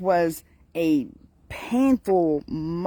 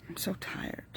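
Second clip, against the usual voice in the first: very soft, barely voiced tired sigh of words; weary; 16 dB quieter than usual. Real, not acted. Vlogger admitting to viewers that she is worn out, weary and hushed. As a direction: let it out faintly, worn out. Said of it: "for me to say I'm tired".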